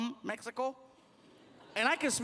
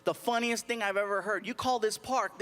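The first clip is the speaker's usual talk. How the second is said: ordinary talk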